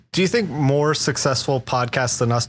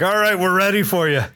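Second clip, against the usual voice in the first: put-on half-formed Scottish accent, pitched up; attempted Scottish accent; 4 semitones higher than usual. Scottish acupuncturist calling in a patient, attempted Scottish accent. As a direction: have an unsure stab at a Scottish accent. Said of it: "was I attempting a Scottish accent".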